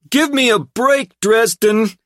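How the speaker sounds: high, loud brash thug; relieved brash hoodlum voice. A narrator's reading. Tripp character voice.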